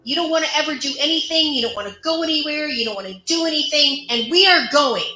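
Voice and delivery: high, fast, loud imitation of an angry partner; fed-up tirade. Boyfriend sick and tired of girlfriend, acted fed-up tirade. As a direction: act out an exasperated rant, hitting key words hard.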